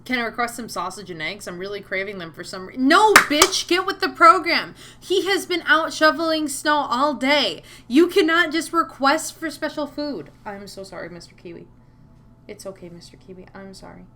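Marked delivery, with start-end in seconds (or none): 2.8-10.3 loud, high-pitched outburst; exasperated scolding. Real, not acted. Scolding a game character's request. 10.5-14.1 very soft, hushed apology to a pet; soothing apology. Real, not acted. Soothing pet startled while sleeping.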